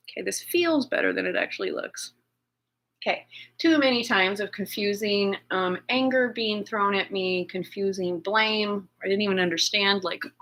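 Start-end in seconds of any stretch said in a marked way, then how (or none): none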